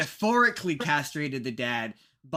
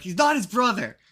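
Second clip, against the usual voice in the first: mock exasperated correction; 7 semitones higher, 6 dB louder than usual. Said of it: "You're like".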